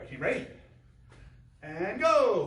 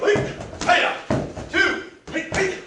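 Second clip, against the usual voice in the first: kiai shout; 8 semitones higher, 6 dB louder, much slower than usual. Real, not acted.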